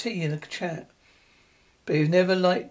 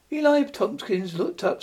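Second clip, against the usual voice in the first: ordinary delivery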